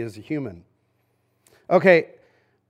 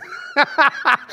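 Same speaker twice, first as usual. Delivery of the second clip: bright excited giggle, pitched up; giddy high laugh; 8 semitones higher than usual. Retold or imitated. Pastor as hockey fan, breaking into a high giddy laugh. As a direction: laugh like a fan who can't believe it. Fans thrilled Gretzky joined the Kings.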